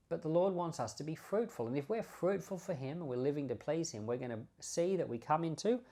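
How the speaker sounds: usual speaking voice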